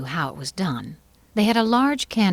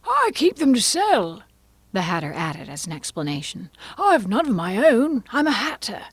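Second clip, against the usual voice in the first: Hatter voice; 5 semitones higher, 3 dB louder than usual. A narrator's reading.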